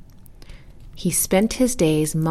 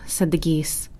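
ordinary delivery

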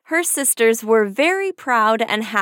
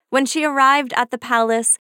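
ordinary delivery, nothing marked

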